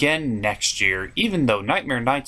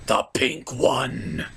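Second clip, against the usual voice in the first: slow whispered reveal; sinister whisper; 3 semitones lower, much slower than usual. Acted. To Luna fans, pointing out Pinkie, in a slow sinister whisper. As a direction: whisper it like marking a target.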